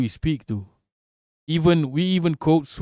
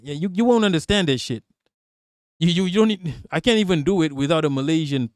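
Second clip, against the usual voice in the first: ordinary delivery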